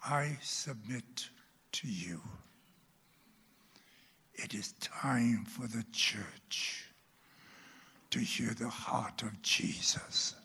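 hushed, whispered preaching; intimate whisper. Real, not acted. Preacher whispering to his congregation about hearing the word. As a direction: draw them in with a whisper.

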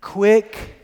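Quick called out loud and high; leading the call. Shown as a demonstration. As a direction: lead the church in Quick. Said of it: "Can you just say that with me".

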